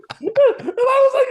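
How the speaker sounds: can barely get it out through the laughing; laughing hard. Real, not acted. To the podcast host, laughing hard at the biker's threat. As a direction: voice squeezed high with helpless laughter.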